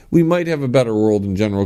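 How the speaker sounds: normal talking voice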